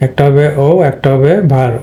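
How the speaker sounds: ordinary delivery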